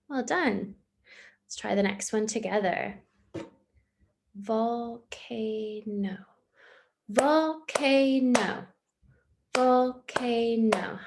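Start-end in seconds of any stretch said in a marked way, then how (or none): 4.4-11.0 "Volcano" drawn out very slowly as vol-ca-no; slow clapped syllables. Shown as a demonstration. Teacher claps "volcano" slowly in three beats. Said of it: "How many claps did you hear?".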